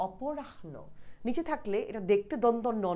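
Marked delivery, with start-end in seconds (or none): 0.1-0.7 অপরাহ্ন slowly and softly, oporah-no; demonstrated pronunciation. Shown as a demonstration. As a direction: breathe the h of অপরাহ্ন before the n. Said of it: "যে এরকম".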